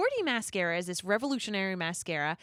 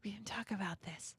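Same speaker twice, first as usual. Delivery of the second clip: urgent hushed whisper; worried whisper to cohost; whisper yelling; 11 dB quieter than usual. Real, not acted. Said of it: "Why are we whispering?".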